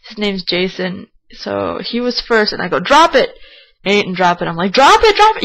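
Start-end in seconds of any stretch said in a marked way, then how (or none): 2.8-3.3 high, loud urgent order; retold yell. Boater ordering friend to drop the anchor, retold yell. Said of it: "I'm yelling at him". 4.7-5.4 very high, loud repeated order; urgent yell, pitched way up; retold yelling. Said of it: "I'm yelling at him".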